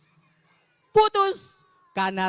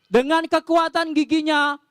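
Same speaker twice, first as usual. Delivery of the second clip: ordinary delivery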